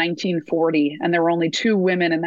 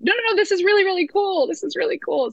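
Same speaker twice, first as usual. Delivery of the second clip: much higher, eager protest; excited insisting; 12 semitones higher than usual. Retold or imitated. Granddaughter urging grandma her fan mail matters, excited and much higher. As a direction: gush that it's really cool.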